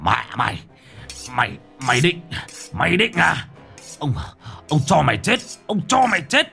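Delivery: hissing with rage. A narrator's reading. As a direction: spit the threat out through teeth. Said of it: "Ông rít lên".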